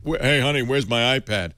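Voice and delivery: put-on light Boston tough-guy accent; a hint of a macho Boston boxer. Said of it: "He's got a Boston accent".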